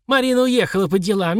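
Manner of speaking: put-on cranky old codger doubting; old prospector. Old man voice.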